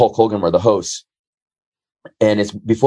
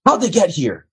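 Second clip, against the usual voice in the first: high, commanding mock producer; retold producer ordering him to sit; bossy producer voice; 7 semitones higher than usual.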